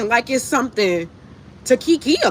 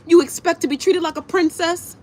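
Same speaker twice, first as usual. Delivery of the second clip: mocking; 4 semitones higher than usual.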